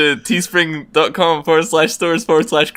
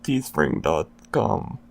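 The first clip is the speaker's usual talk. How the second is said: slow, low robot voice; droning robot ad voice; 4 semitones lower, 7 dB quieter, slower than usual. Pretend. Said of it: "a robotic voice every week that just goes".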